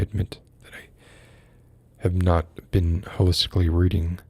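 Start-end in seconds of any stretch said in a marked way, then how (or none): 0.0-0.9 unvoiced whisper, as if caught out; whispered confession. Whispering a guilty admission to the teacher. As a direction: admit it under your breath to the teacher.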